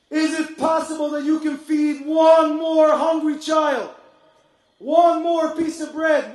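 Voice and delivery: loud, slow, pleading shouts from the pulpit; preaching at full force. Acted. Climactic plea for donations.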